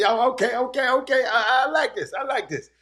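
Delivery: high, excited approval; delighted self-quote. Retold or imitated. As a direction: re-enact your rising delight, high and loud at first. Re-enacts delight at seeing press spot.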